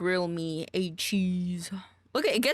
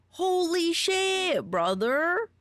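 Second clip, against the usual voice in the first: much higher, slow, drawn-out holy shit; stunned high squeal; awed excitement; 10 semitones higher, 3 dB louder, much slower than usual. Real, not acted. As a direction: let the amazement stretch out. Raving about a voice she loves.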